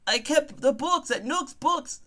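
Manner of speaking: high-pitched, protesting comic voice; indignant accused bookkeeper. Acted. Murder suspect insisting on an innocent job, high indignant protest.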